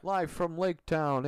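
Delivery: high, slow, loud TV announcer. Pretend. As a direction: boom it like a late-night show intro. Mock talk show opening.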